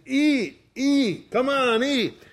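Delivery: high-pitched, coaxing insistence; urging hostess. Retold or imitated. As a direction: imitate a host pushing food on guests. Translating cousin's urging to eat.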